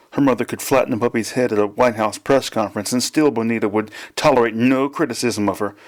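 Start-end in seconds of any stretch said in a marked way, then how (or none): none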